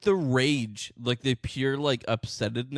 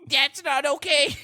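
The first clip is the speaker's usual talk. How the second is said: higher, slow, indignant imitation of the ranting man; mock angry short man; 11 semitones higher, 4 dB louder, slower than usual. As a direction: ham up his outraged whine. Mocking the short man's rant.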